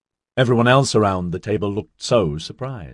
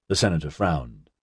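ordinary delivery, nothing marked

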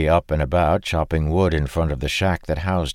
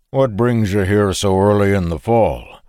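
louder Frisian farmer's query; 3 semitones higher, 5 dB louder than usual. Acted. Farmer questions the stranger in a Frisian accent. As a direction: Frisian accent, a bit suspicious. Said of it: "his Frisian accent".

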